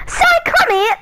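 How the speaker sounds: high dad voice calling out. Acted. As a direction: call the son in the high dad voice.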